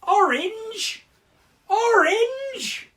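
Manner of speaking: high, loud cry of the colour name; excited shout. Acted. Teacher thrilled to reveal the next colour, high excited shout. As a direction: burst out with the tricky colour.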